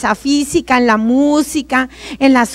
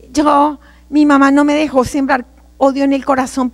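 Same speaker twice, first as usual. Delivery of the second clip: ordinary talk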